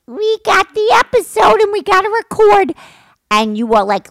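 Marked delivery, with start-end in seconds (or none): 0.1-2.7 high, loud excited mimicry; imitating daughter. Mom mimics daughter pushing to record the episode, mock-eager. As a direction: pipe it up loudly, mocking her eagerness. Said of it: "So now you're going".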